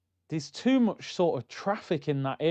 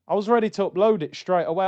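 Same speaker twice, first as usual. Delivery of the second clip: usual speaking voice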